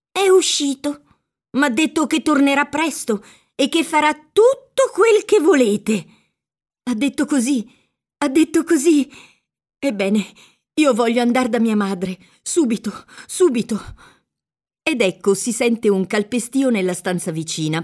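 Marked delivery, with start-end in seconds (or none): 0.1-6.1 put-on high-pitched, emphatic old woman; eager appeasing in a raised pitch; anxious placating. A narrator's reading. Old woman placates the captive girl. 6.8-13.8 higher-pitched, urgent pleading; a captive girl's stubborn plea; insistent demand. A narrator's reading. Captive Lucia insists on leaving.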